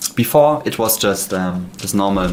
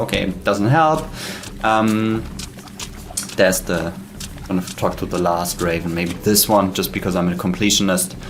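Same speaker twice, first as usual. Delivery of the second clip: normal talking voice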